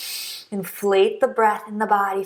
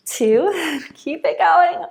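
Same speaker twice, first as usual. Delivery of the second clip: higher, louder encouragement through a laugh; amused, high-pitched push to keep going; real laughing; 8 semitones higher, 4 dB louder, much slower than usual. Real, not acted. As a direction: laugh while cheering them through the last counts. Laughs while counting down the hold.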